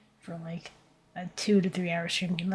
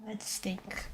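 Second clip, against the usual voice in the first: whispering; 11 dB quieter than usual. Real, not acted. Thinking aloud while drawing.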